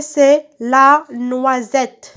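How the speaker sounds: la noisette very slow, NOI and SETTE stretched; slow sounding-out. Shown as a demonstration. Teacher answering what the picture is, slow sounding-out. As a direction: draw out each syllable of la noisette.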